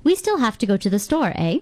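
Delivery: store said plainly, then eh lifted up at the end; a friendly tag question ending in eh; rising eh. Shown as a demonstration. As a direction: finish on a bright questioning eh. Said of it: "For example".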